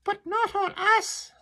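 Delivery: very high squeaky cry; tiny oysters crying out in alarm; frightened oysters. Acted. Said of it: "the oysters cried".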